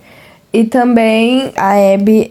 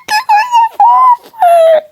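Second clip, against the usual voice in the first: very high, slow, loud squeal; 3 dB louder, slower than usual. Real, not acted. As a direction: shriek with delight at a cute thing. Squealing over cute photo.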